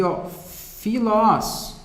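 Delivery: ordinary talk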